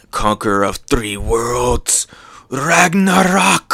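theatrical move announcement; villain attack call. Acted. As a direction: build to a long drawn-out final word.

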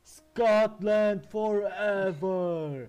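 put-on drawn-out, high Scottish-accented roar; Scottish battle cry. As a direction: bellow it like a Scottish war cry, stretching each word. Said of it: "Scottish accent is".

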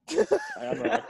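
high, laughing protest. Real, not acted. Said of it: "you see how he gets aggravated so easily".

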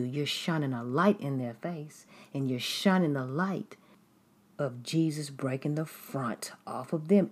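usual speaking voice

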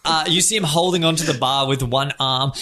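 ordinary talk

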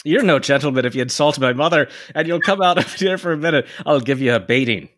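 high-pitched stage-Irish fighting talk; heated Irish caricature; hot-tempered Irish threat. Acted. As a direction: challenge him in a caricature brogue. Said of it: "returned Andy hotly".

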